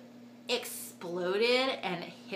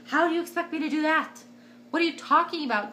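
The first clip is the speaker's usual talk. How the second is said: high, fast, louder exasperated comeback; frustrated retort; 7 semitones higher, 6 dB louder, faster than usual. Retold or imitated. To the nurse who says don't push, an exasperated high retort. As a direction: throw it back at the nurse in disbelief. Retold yelling at the nurse mid-labour.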